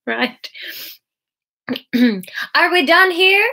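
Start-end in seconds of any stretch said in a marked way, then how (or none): 2.6-3.5 loud, high shout to the sky. A narrator's reading. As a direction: shout the question upward, impatiently. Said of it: "the devil shouted into the sky".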